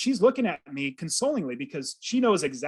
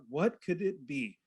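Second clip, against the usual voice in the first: soft and slow, half to himself; quiet inner wondering; 6 dB quieter, slower than usual. Retold or imitated. Alone after the call, wondering softly and slowly. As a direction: ask it quietly, turning it over slowly.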